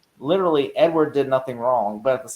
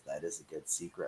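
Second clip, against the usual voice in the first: low, much softer menacing line; quiet sinister villain; 9 semitones lower, 17 dB quieter than usual. Retold or imitated. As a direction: hush it like a smug threat. Quoting the villain's reply.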